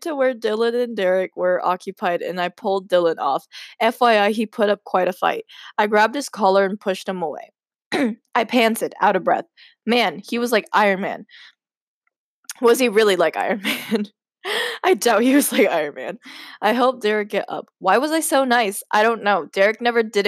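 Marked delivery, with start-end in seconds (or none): none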